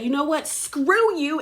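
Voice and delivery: mock indignant, pitched high; worked up. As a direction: imitate a worked-up kiss-off without shouting. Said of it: "she gets so worked up".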